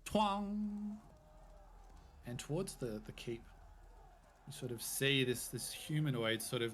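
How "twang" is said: high bowstring twang; twang sound effect. Retold or imitated. The GM makes the crossbow firing sound, high twang. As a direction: pluck it like a crossbow string. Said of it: "A loud thud".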